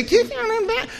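high, sing-song mock cheerfulness; mocking AA cheer. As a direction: parody the chirpy AA greeters. Mocking AA slogan again.

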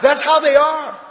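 mock him loudly; mocking shout. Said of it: "He's going like this".

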